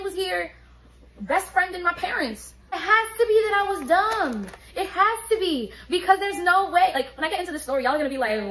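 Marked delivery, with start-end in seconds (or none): 2.7-6.9 higher, louder insistence; self-mocking emphasis; emphatic disbelief. Real, not acted. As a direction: drive home how dumb she was.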